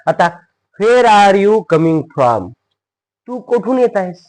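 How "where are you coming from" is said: loud, high, drawn-out words; slow model reading. Shown as a demonstration. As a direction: project each word slowly to learners. New sentence read out slowly first. (सावकाश, मोठ्या आणि उंच आवाजात)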